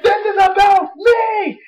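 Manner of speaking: high, slow shouted whine; mock a self-centred wrestler; Daniel Bryan whine. Fan mimicking Daniel Bryan's complaint, high slow shout. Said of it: "He's like".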